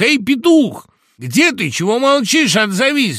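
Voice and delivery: high, loud hail to someone out of sight; calling out. A narrator's reading. Woodpecker calling the silent rooster, loud high calling. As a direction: call up insistently for an answer. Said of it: "звал дятел петуха".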